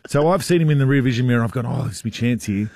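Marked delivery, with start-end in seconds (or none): none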